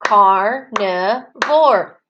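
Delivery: "Carnivore" very slowly, one beat per syllable; teacher chanting "Carnivore" syllable by syllable; clapped-out syllables. Shown as a demonstration. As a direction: split "Carnivore" into three spaced chunks. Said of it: "One more time".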